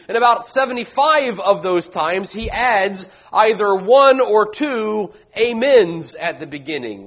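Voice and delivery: ordinary delivery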